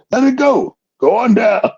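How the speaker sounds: high, loud teasing command; mock shout. Speaker telling Jim to let go, high loud mocking. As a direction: call it out as a joke. Speaker's own punchline advice.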